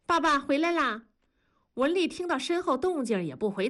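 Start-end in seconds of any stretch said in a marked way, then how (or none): 0.0-1.0 put-on high child voice announcing dad; lightly voiced kids' call; children calling out. A narrator's reading. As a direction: call out like an excited child, pitched up. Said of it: "叶妮和南方就喊".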